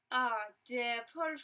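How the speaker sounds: ordinary talk, nothing marked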